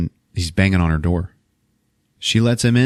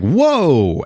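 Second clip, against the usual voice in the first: high, swooping startled whoa; mock alarm; 7 semitones higher, 4 dB louder than usual. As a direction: act out a startled reaction. Startled reaction in retold story.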